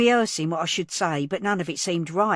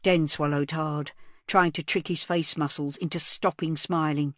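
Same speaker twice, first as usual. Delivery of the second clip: usual speaking voice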